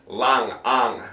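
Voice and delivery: "lang" with an open "ah"; "ah" vowel. Shown as a demonstration. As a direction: say "lang" with "ah", not a flat English A. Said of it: "it's a a sound".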